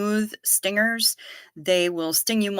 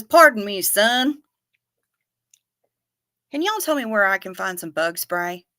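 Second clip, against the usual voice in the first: put-on Southern accent; 3 dB louder than usual. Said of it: "so he says".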